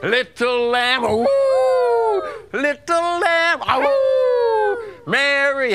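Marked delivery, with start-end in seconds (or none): 1.0-2.2 wolf howl. Pretend. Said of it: "every time I say lamb". 3.7-4.8 wolf howl. Pretend. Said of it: "every time I say lamb".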